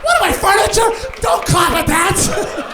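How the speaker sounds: high, loud indignant shout; hammed-up protest, pitch way up; mock outrage. Comic scolding the crowd for clapping, high loud mock outrage.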